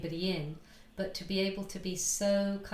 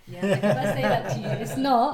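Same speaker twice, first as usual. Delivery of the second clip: laughing; 2 semitones higher, 14 dB louder than usual. Real, not acted. Joking about the messy studio.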